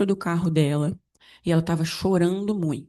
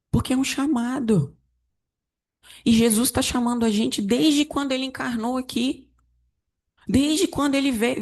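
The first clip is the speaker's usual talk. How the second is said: raised, higher preaching voice; 7 semitones higher than usual. Acted. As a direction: lift it up like a sermon call. Pregação com voz elevada. (pregação em tom elevado)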